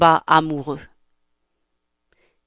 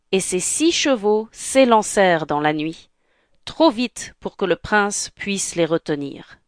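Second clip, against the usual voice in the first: ordinary delivery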